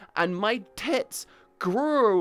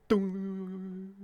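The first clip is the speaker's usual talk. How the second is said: long ringing "dunnng"; rune pan hit; 4 dB quieter than usual. Retold or imitated. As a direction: imitate a pan's drawn-out ring. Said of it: "a rune one. It goes".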